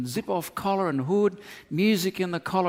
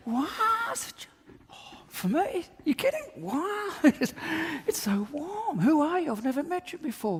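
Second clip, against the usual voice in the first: squealing high-pitched gratitude, voice cracking up in joy; over-the-top delight; 12 semitones higher, 4 dB quieter than usual. Pretend. Man in a singlet thrilled by a stranger's jacket, squealing high. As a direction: gush with shrill surprise at the gift. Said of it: "Or he can say".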